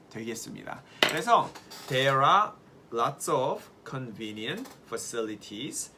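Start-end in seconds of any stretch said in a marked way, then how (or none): 1.9-5.9 very slow, every word spelled out; slow model sentence. Shown as a demonstration. Teacher reads model sentence to the class, very slowly. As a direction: read it slowly for learners to copy. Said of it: "따라해보세요".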